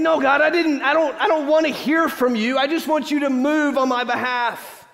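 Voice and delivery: high loud protesting whine; stubborn complaining Israelite. Acted. Israelite refusing God's word, high loud protest. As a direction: refuse the prophet, demand action.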